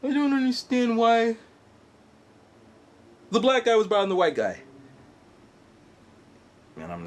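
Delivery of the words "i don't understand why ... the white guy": put-on 1 puzzled girlfriend. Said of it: "were just like".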